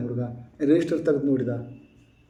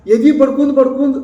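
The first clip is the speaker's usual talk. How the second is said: preaching outcry; 10 semitones higher, 8 dB louder than usual. Acted. Preacher mocks chest-beating mourners.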